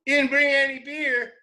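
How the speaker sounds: high, fast hillbilly rant; high indignant country yelling; mock outrage. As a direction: scold them for no beer, hammed up. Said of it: "he starts yelling at him".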